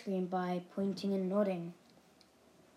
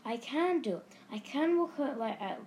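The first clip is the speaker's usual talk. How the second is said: raised, triumphant pitch; 5 semitones higher, 4 dB louder than usual. A narrator's reading. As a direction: announce it with proud delight. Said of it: "said Tony triumphantly".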